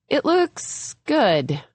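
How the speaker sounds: slow, drawn-out and doubtful; unconvincing. A narrator's reading. Violet unconvincingly praising the choppy bangs, slow and doubtful. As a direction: say it like you don't believe it. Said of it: "she says unconvincingly".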